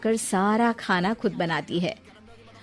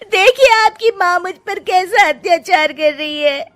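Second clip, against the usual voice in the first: higher, louder whiny false tears; fake crying complaint; 10 semitones higher, 7 dB louder than usual. Daughter-in-law faking tears to her husband, high and whiny. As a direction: play the victim to win him over. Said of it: "रोने का नाटक करने लगती है".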